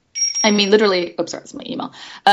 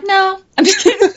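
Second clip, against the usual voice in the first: high, louder playful tease; mock joking reply; 13 semitones higher, 6 dB louder than usual. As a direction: toss it off as a cheeky joke. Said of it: "I'm like".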